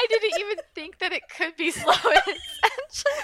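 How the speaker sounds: high, laughing disbelief. Real, not acted. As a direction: giggle through the words. Laughing about the book.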